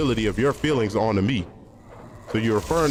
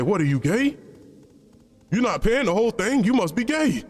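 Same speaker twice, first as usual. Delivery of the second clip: a hint of a mocking homie, much higher; 9 semitones higher than usual. Imitating homies teasing him about paying, higher and mocking. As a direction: tease him like a buddy, pitched way up. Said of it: "they'll say".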